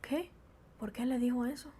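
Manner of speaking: hushed, shocked murmur; taken aback. Acted. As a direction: whisper it in puzzled disbelief. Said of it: "los mexicanos se quedarían así".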